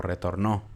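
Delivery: "retornó" stressed on the last syllable NÓ; final stress. Shown as a demonstration. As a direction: put the force on the end of "retornó". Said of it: "podría ser retornó". ("retornó" con la fuerza en NÓ)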